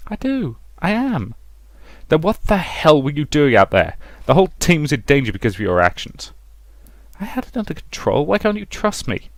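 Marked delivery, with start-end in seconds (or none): 0.1-1.3 high insistent protest. Acted. Scolded officer insists. 2.1-5.6 angry demand. Acted. Major scolding lieutenant. 7.2-9.3 hurt pleading. Acted. Hurt protest to major.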